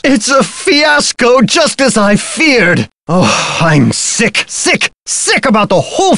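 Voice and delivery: melodramatic dismay. Acted. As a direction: lament a disaster like a stage diva. Melodramatic lament over thefts.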